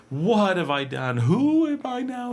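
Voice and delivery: drawn-out, high-pitched wailing questions; hammed-up anguish, climbing high on the second question; mock melodrama. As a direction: overact an emotional crisis, slowly and dramatically. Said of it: "everything's so dramatic".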